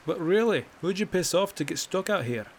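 a sly poke at his posting; teasing question. Acted. Bracknell teasing the guard.

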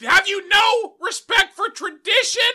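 very high, very loud raging pig voice; furious hog squeal. Acted. Hog outraged at the pear, furious squealing. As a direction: rage like an insulted pig.

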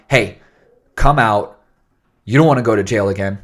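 loud, firm order; authoritative. Acted. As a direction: command them like police at the door. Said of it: "less authoritative, not like".

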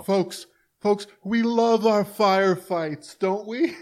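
high, drawn-out crowd-working voice; folksy politician impression. Politician rousing the folks about firefights, mock folksy.

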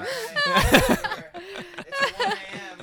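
slow, squeaky whatever through laughter; mock resigned. Commuter shrugging at Jason at one a.m., laughing resignation.

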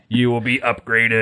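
flat robotic monotone. Retold or imitated. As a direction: intone it like a machine. Dalek/Cybermen robot voice quoted.

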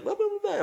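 very high-pitched babble of adults talking; imitated distant chatter. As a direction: mimic indistinct chatter in a squeaky register. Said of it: "you hear them, like".

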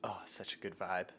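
low and soft; hushed vibe voice. Pretend. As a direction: murmur it contentedly. Same artist character.

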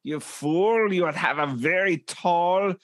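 hammed-up German scolding, relishing every word; mock German accent. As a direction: play it big with a put-on German accent. Scolding in German food character.

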